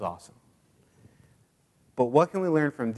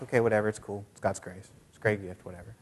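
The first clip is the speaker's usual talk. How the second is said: offhand mutter, getting lower and softer; dismissive; 4 semitones lower, 6 dB quieter than usual. Acted. Believer brushing off God's gift, low and dismissive. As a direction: shrug it off, trailing away. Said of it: "like, flicking God off".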